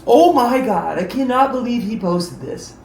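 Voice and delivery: first seeing the video posted, in disbelief. Retold or imitated. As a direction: sound floored by it. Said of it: "I was like".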